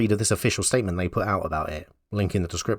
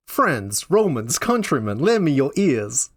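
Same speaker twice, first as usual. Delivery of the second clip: declaimed in a put-on southern accent; raised, swooping southern accent; 4 semitones higher, slower than usual. Shown as a demonstration. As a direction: call out Shakespeare like a southern orator. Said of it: "do it in a southern accent".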